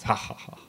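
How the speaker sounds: breathy mock laugh. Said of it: "I laugh myself to death".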